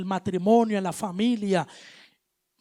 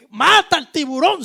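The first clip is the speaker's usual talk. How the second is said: shouted plea, much louder and higher; grito desesperado; 5 semitones higher, 8 dB louder than usual. Retold or imitated. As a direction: yell it up at God like a demand. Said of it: "Tú le pegas un grito". (grito fuerte y agudo de súplica)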